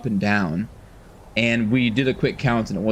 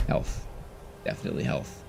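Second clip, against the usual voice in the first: usual speaking voice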